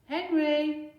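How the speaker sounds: loud, high shout from the kitchen; mother calling sharply; Mum shouting. Acted. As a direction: yell his name across the house. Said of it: "shouted Mum".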